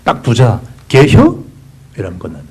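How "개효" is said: high, loud clipped Chungcheong question; Chungcheong dialect. Retold or imitated. Preacher mimicking Chungcheong folk asking about dog soup, Chungcheong accent. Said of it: "충청도 사람들 더 간단한데".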